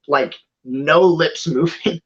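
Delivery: ordinary delivery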